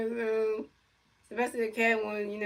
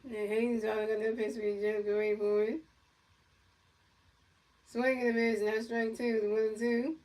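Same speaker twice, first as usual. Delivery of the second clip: play-by-play announcer. Pretend. Kid calling a pretend Mets game, announcer style. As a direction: pretend baseball commentator calling the pitches.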